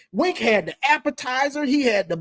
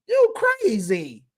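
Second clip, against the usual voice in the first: teasing; 8 semitones higher, 3 dB quieter, slower than usual. Real, not acted. Teasing Caleb.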